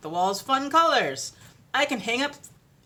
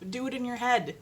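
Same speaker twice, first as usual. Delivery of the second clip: ordinary talk, nothing marked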